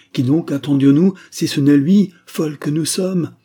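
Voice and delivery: loud, high exasperated questioning; oppressed cry. A narrator's reading. Mother challenges her silent daughter, agitated outcry. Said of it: "s'écria la commandante avec une voix oppressée".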